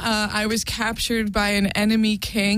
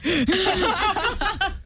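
high creepy chuckle; a creepy laugh that climbs high; 11 semitones higher than usual. Shown as a demonstration. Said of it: "Oh, see, that's just creepy".

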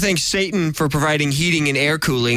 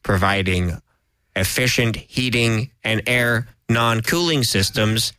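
NPR sponsor read; 6 semitones lower, slower than usual. Pretend. Mock NPR sponsor announcement.

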